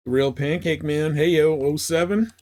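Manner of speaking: normal talking voice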